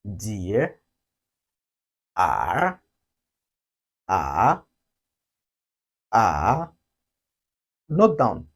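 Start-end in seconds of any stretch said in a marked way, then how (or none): 0.1-0.8 DEAR starting low, then rising; low dip and upswing on DEAR; falling-rising tone. Shown as a demonstration. English word to recall the sound, dipping DEAR. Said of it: "to record a sound". 2.1-6.7 AH dips low, then rises; third tone. Shown as a demonstration. Teacher models the third tone, falling-rising AH. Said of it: "drops a little".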